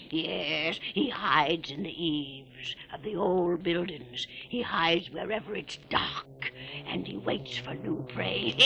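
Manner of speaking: put-on spooky old keeper spinning a tale; creepy old sea keeper. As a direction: relish every grisly detail. Old keeper tells horror tale.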